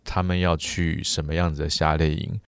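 ordinary delivery

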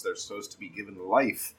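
ordinary delivery

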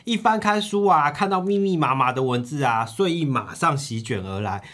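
usual speaking voice

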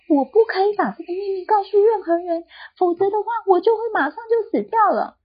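high, panicked protest; frightened barber. Acted. Young barber refusing the doctor, high and panicked. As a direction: plead in alarm, voice up high.

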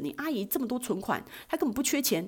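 ordinary delivery

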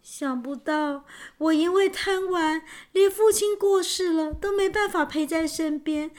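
high-pitched lament of regret; self-reproachful. A narrator's reading. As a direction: blame yourself, voice lifted in grief. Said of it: "王子自责地说". (自责地哀叹)

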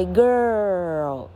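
put-on girl drawn out as "gerrr-l"; slow, gliding model of girl; long r-coloured vowel. Shown as a demonstration. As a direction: hold the curled "er" in girl. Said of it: "也是这样念".

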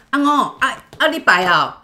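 loud, high greeting; neighbor calling out. Retold or imitated. Neighbor Pan's mom calling out as she arrives, loud. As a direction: call out to the house on arrival.

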